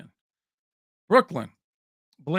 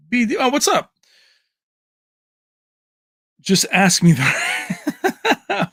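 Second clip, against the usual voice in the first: excited shout; 8 semitones higher, 7 dB louder, much slower than usual. Real, not acted. Shouting greeting at a chatter.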